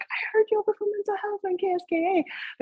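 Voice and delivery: high-pitched imitation of an excited friend. Friends messaging about hearing the radio spot, high excited imitation.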